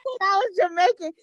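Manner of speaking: laughing, high and loud teasing repeat; laughing mock shout. Real, not acted. Mocks friend's Jamaican line.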